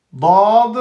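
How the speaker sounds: ordinary talk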